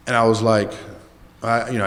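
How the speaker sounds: ordinary delivery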